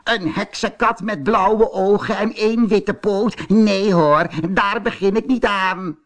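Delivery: high, shrill-voiced screech of a witch. A narrator's reading. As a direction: screech it out like an old witch refusing. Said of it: "krijste ze".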